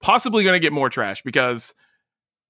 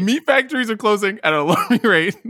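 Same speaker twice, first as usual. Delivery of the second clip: breaking into laughter mid-sentence; can't keep a straight face; laughing through it; 6 semitones higher than usual. Real, not acted. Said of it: "Now I can't even get through this".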